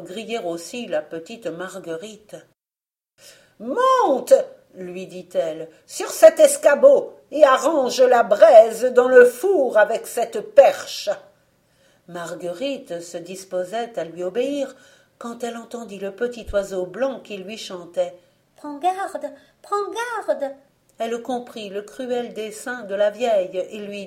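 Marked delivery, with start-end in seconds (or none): 3.6-4.3 high, loud witch order; commanding witch. Acted. Witch ordering Marguerite onto the stool, sharp command. 5.9-11.1 high, loud witch order; commanding witch. Acted. Witch ordering Marguerite onto the stool, sharp command. 18.5-20.5 high bird voice warning; lightly voiced little bird. Little bird warns Marguerite.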